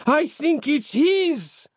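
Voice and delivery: high, drawn-out cry. A narrator's reading. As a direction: exclaim it high and slow to your father. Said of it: "he cried, addressing Giuseppe".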